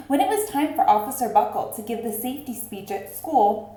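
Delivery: usual speaking voice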